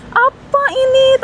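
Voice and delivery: high, drawn-out exclamation of disbelief; mock indignant reaction. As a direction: act out being taken aback, pitch leaping up. Acted reaction to angry driver. (pura-pura kaget dan kesal)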